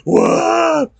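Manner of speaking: high, loud, hammed-up What; exaggerated offended shock; mock surprise. Feigned shock at friend's jab.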